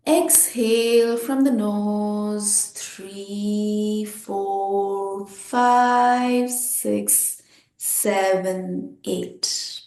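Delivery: slow, drawn-out counting; sustained count that softens at the end; exhale count. Shown as a demonstration. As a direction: stretch the numbers to pace a long breath out.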